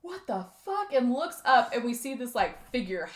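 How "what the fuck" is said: higher, stunned curse; shocked dad. Retold or imitated. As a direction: imitate the dad's disbelief at the arrow. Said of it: "the dad is like".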